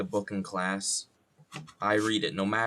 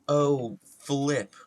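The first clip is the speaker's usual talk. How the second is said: higher, punched-out mock exclamation; fake cursing; 5 semitones higher, 6 dB louder than usual. Imitating video makers dodging curses, loud mock dismay. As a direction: exaggerate the clean swear word. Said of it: "they'd be like".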